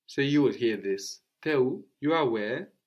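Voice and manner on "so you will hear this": normal talking voice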